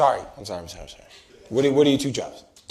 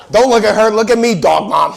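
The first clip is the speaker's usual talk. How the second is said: loud, high-pitched comic order; mock scolding; 11 semitones higher, 11 dB louder than usual. Comic ordering an audience member to face him, mock scolding shout. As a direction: shout for their attention, hammed up.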